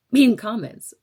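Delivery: frustrated outburst; exasperated. Real, not acted. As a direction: push it out, fed up. Venting about comments.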